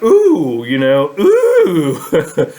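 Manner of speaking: high, loud gleeful ooh with a laugh; delighted ooh. Retold or imitated. Finding the golden nugget in the dream, loud high delighted squeal. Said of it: "I was like".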